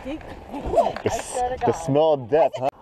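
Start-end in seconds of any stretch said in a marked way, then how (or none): none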